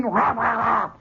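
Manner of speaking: funny angry growl; angry noise. Retold or imitated. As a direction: imitate a cross, snorting roar. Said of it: "sort of an angry noise".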